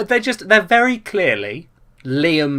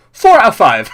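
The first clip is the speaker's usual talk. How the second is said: sudden verdict; 7 semitones higher, 10 dB louder than usual. Retold or imitated. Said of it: "suddenly you'll go".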